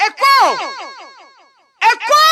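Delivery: high, loud call; loud echo call. Shown as a demonstration. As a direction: belt it out to test the echo. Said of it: "ça doit dire fort". (crier « écho » bien fort)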